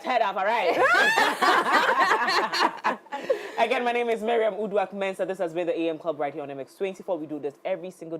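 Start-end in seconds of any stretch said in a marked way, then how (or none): 0.8-3.5 long, high burst of laughter; real high-pitched laughing fit; big laugh. Real, not acted. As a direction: laugh out loud, high and unrestrained. Hosts laugh at closing joke.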